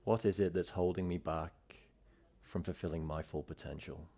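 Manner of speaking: calm, steady yoga teacher voice; clear, even instructor cues; yoga class cueing. Acted. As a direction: guide the class into the pose. Guided intention and flow cueing.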